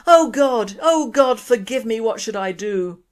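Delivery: loud desperate plea to God; crying out. A narrator's reading. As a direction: cry out begging forgiveness. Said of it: "got down on his knees and cried".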